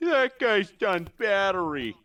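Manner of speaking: high, slow, drawn-out yokel voice; hammed-up hillbilly. Mocking dialect voice.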